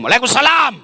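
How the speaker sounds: a wife's firm greeting; mock firm reply. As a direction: answer crisply, no sweetness. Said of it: "Coba kalau suamimu tegas".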